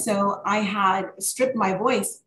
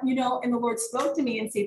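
normal talking voice

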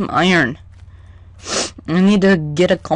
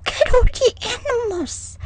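much higher, thrilled; excited squeal; 3 dB quieter than usual. Real, not acted. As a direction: gush over the animals. Excited about animals.

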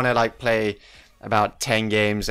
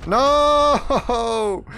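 shout of dismay; 13 semitones higher, 5 dB louder than usual. Real, not acted. Teammate runs in front, dies.

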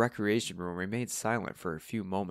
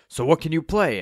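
loud, higher, eager question; lightly voiced Steve; 8 semitones higher, 10 dB louder than usual. A narrator's reading. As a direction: ask it keenly across the piano. Steve challenges the pianist.

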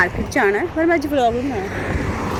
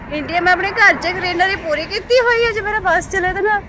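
higher and louder, growing eager; excited; 7 semitones higher, 7 dB louder than usual. Real, not acted. Vlogger telling viewers she'd plant trees across India, eager and raised. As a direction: dream big out loud, rising. Dreams of planting greenery everywhere.

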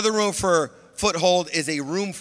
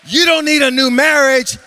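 loud, high preacher's cry over music; shouted sermon climax; preaching at full force; 7 semitones higher, 9 dB louder than usual. Acted. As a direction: preach it out to the crowd.

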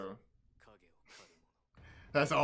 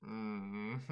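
ordinary talk, nothing marked

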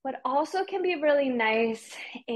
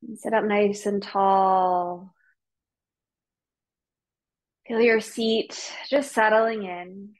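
guided meditation; 3 semitones lower than usual. Real, not acted. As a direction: ease them gently into stillness, unhurried. Leads the class into meditation.

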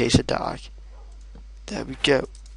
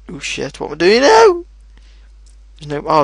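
usual speaking voice

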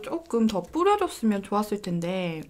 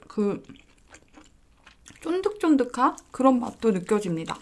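chewing while talking; talking through a bite; mouth full. Real, not acted. Seen 0: Bites at -2.0; lips closed and cheeks full while speaking after.

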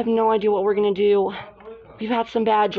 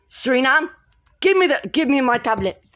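usual speaking voice

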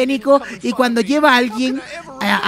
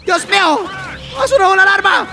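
alarmed yelling; 6 semitones higher than usual. Real, not acted. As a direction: yell a warning as the alarm goes off. Alarm sounds, gamer yells at pilot.

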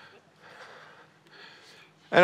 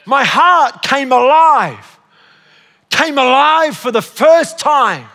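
slow, emphatic declamation building higher; preached song lyrics; 6 semitones higher, 6 dB louder, much slower than usual. Real, not acted. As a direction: proclaim each phrase with rising fervour.

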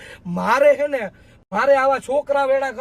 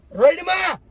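angry shout; 3 semitones higher, slower than usual. Acted. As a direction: shout her down in anger. Boyfriend shouts in anger.

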